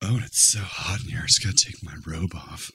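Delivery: soft low sultry murmur; sultry mock murmur. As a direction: purr it like a cheesy seduction. Sleazy innuendo bit.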